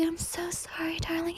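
high, whispery apology; Nikki. Acted. Toxic girlfriend apologizing to darling, high and hushed. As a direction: sink into a soft, high whisper. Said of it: "You know how she keeps saying".

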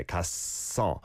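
갔어 split as gat-sseo with a held ss; 갔어 with a long double s; double siot. Shown as a demonstration. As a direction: stop on the first syllable of 갔어, then tense s. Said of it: "double shiot sound is on that first syllable".

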